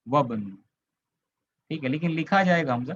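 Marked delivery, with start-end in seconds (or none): 0.1-0.5 "वबन" joined with no i after wa; linked reading. Shown as a demonstration. Teacher showing what is written but not read, "वबन" linked without i. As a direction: go straight from wa to b in "वबन". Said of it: "ऐसे पढ़ेंगे".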